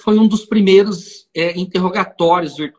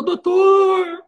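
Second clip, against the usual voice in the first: mock weeping plea; 10 semitones higher than usual. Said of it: "agora vem o drama".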